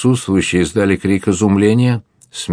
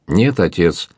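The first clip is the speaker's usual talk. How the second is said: usual speaking voice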